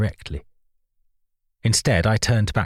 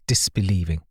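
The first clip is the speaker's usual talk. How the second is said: ordinary delivery, nothing marked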